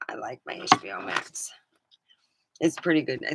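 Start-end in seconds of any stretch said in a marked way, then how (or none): 0.1-1.5 whisper. Real, not acted. Aside about his streaming service.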